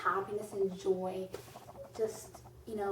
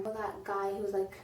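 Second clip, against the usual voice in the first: usual speaking voice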